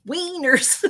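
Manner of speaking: tickled by a silly word; amused. Real, not acted. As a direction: say it high and giggle after. Repeats the word, breaking into laughter.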